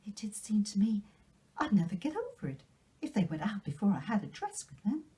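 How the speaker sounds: put-on high, breathless delighted girl; young girl bubbling over with Christmas wonder; excited Anne. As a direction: gush with joy over the gift. Anne too excited to eat.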